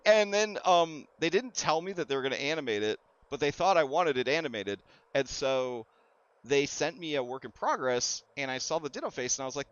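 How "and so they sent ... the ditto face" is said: normal talking voice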